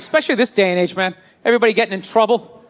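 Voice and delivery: usual speaking voice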